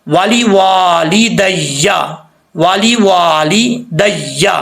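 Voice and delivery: वाली वाली दैया slowly with a doubled या in दैया; slow demo recitation; slow model reading. Shown as a demonstration. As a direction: draw out दैया carefully. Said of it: "या जबर या".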